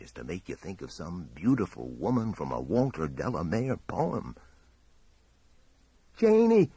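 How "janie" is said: high, loud cry of a name; full-voiced high call. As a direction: shout it desperately across the distance. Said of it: "Lumley cried".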